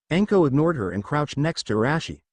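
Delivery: usual speaking voice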